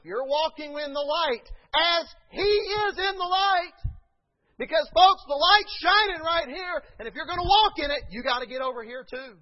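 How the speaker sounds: high, loud shouted climax; preaching shout. Acted. Pastor shouting to the congregation, full force. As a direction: ring it out to the back pews.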